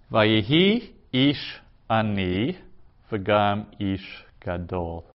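very slow, word-by-word Hebrew reading; reading aloud for learners. Shown as a demonstration. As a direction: model each word slowly, pausing between words. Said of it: "I'll read the first sentence".

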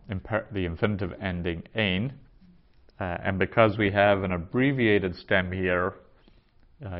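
usual speaking voice

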